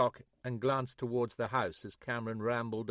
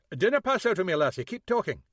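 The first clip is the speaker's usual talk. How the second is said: put-on Scottish accent; 8 semitones higher, 7 dB louder than usual. Scottish Monroe urging May.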